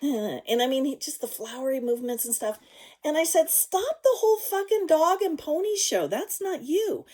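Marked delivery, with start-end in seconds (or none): 3.5-7.0 high, loud retold demand; exasperated outburst, pitched right up; heated confrontation. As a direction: call a friend out forcefully. Retold blunt confrontation of friend.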